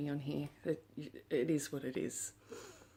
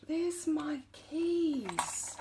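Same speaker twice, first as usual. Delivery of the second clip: much higher, very slow and drawn out, then a gasp; delighted gasp; 10 semitones higher, much slower than usual. Real, not acted. Drawn-out delight, to viewers, on spotting her lost keys. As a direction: stretch it out in pure delight.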